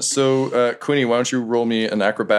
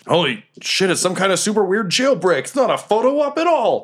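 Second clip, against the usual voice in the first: guards rising higher and higher in alarm; panicked guards; 10 semitones higher than usual. Acted. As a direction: guards climbing into a high panic. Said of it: "they're like".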